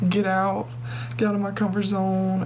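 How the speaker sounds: usual speaking voice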